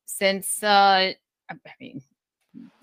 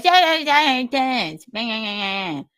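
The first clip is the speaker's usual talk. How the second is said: impression of Kevin; 3 semitones higher than usual. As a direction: imitate Kevin brushing her off with rapid yeahs. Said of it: "It's pretty good impression".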